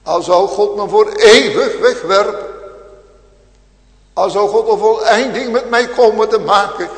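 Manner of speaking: full-force sermon voice; fiery preaching. Acted. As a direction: thunder it at the congregation.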